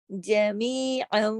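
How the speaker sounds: drawn-out recitation; slow tajweed recitation. Shown as a demonstration. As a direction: stretch the madd slowly. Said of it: "وہ ختم ہو جائے گی". (آہستہ تلاوت)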